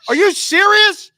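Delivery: high, loud, slow shocked disbelief; wife can't believe it; disbelieving wife. Retold or imitated. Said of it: "She goes".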